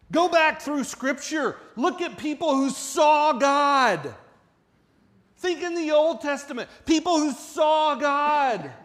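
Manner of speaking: loud, high, slow preaching; loud, high, slow sermon climax with a cry of Isaiah's awe; full-force preaching. Acted.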